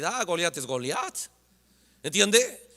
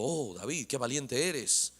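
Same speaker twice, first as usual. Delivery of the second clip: mock praise; 5 semitones lower, 9 dB quieter, slower than usual. Mocking Saul quote.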